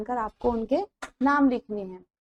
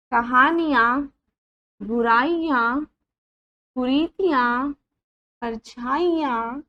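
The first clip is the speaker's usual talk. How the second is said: put-on careful teacher reading each word for children; slow word drill; 2 semitones higher, 4 dB louder, slower than usual. Shown as a demonstration. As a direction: spell out every word clearly, one by one, for the class. Teacher reads word list aloud. (बच्चों को शब्द साफ़-साफ़ पढ़ाती हुई)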